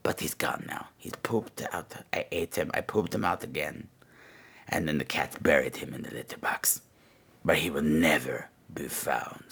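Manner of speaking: very soft, low, sinister whisper; creepy whisper. Acted. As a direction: whisper the grisly ending.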